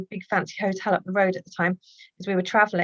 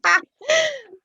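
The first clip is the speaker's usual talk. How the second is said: high laugh; 4 dB louder than usual. Real, not acted. Laughing at the baby story.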